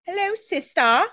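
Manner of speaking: high, slow fake British accent; drawn-out mock British greeting; Alex Drake. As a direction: put on a terrible British accent. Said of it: "It's very Alex Drake".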